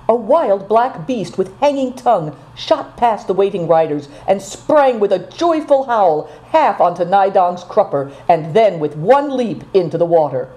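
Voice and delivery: usual speaking voice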